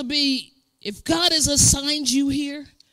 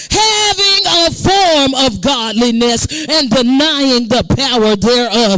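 weighty list, building louder; preached scripture reading; 5 dB louder, slower than usual. Real, not acted. Preacher reading the last days' sins to the church, building preaching. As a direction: hammer each item, building to a peak.

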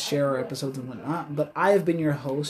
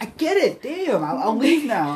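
high, loud, fed-up mock voice of a stranger giving up; hammed-up annoyed stranger backing off; mock exasperation; 8 semitones higher, 5 dB louder than usual.

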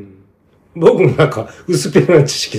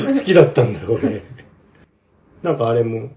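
ordinary delivery

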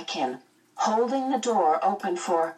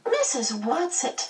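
put-on high little boy, suspicious; wary child, pitched up; 9 semitones higher than usual. A narrator's reading. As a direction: question the visitor with a child's suspicion. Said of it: "Charles said suspiciously".

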